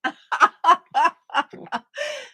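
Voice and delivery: high laughing fit. Real, not acted. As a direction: laugh out loud. Laughing again after reply.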